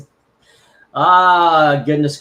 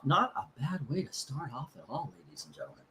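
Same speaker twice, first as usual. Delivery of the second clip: much softer mock-announcer echo; quiet showman's aside to the viewers; soft repeat; 12 dB quieter, faster than usual. Real, not acted. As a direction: murmur the verdict again like a showman under his breath.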